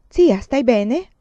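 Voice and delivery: slow, loud, high anxious call; worried call. A narrator's reading. Worried nephew calling aunt. (richiamo preoccupato, lento e forte)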